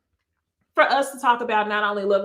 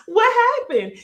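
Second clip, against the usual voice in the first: high, louder mock bafflement; shocked disbelief; 11 semitones higher, 5 dB louder, slower than usual. Host replays her reaction to the accent, mock shocked. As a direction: act floored, pitched right up. Repeats retold shock, laughing.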